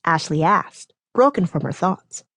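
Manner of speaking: usual speaking voice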